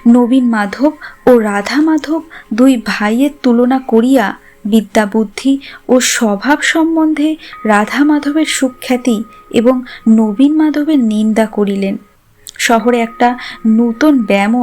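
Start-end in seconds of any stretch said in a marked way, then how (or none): none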